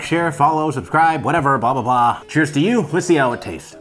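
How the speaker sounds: ordinary talk, nothing marked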